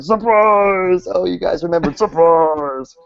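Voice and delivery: high, happy shout of surprise; cheery monster surprise. Retold or imitated. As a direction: cheer it like a party guest. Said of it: "and they're like".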